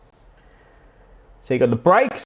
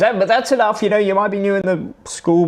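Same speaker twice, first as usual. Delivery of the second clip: mock teacher; 7 semitones higher than usual. Teacher scolding boy in story.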